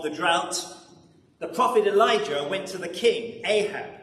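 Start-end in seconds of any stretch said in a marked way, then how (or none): none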